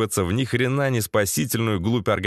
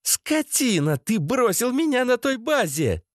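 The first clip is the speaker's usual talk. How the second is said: much higher, indignant female voice; accusing woman; 12 semitones higher than usual. A narrator's reading. Masha accusing the man who abandoned her, high and indignant. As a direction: pitch it way up and accuse him.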